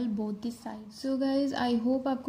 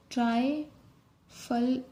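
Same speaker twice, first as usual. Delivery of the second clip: slow dictation; much slower than usual. Shown as a demonstration. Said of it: "dictating a shorthand word".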